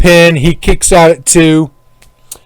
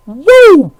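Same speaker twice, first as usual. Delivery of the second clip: high wrestler whoop; excited whoop; 12 semitones higher than usual. Real, not acted. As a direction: let out a high woo. Celebrating with whiskey.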